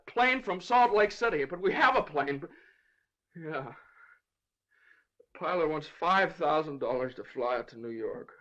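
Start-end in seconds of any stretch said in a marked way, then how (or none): 5.2-8.3 low, soft, defeated; dejected admission. Acted. Father confessing the pilot's price to judge, dejected. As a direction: let the voice sink in discouragement. Father admits he lacks money.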